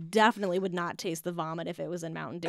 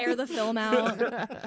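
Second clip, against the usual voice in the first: drawn out, slow, with a laugh; savoring the gag slowly; slow laughing punchline; much slower than usual. Acted. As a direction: stretch the joke out slowly. Joking punchline, slowed.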